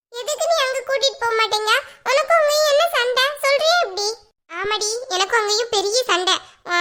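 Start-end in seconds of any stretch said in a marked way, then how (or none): 0.1-4.1 put-on high-pitched little-kid pestering; insistent whiny child. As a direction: demand it like a child who won't give up. Child figure questioning mother.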